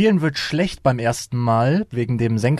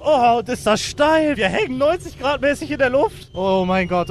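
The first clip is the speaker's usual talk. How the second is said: high, slow thrilled voice; breathless excitement; 9 semitones higher than usual. Real, not acted. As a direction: still riding the rush. Still climbing steeply in glider.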